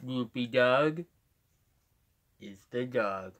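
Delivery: slow cartoon hound voice; Droopy Dog. Shown as a demonstration. Streamer redoing his catchphrase, slow Droopy Dog voice. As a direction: plod along slowly, trailing down. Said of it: "I can do a pretty good droopy dog".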